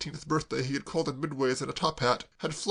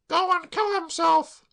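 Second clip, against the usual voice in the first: put-on much higher, slow wretched girl; tearful girl; 7 dB louder, slower than usual. Claire sobbing to Braddock, high tearful wail.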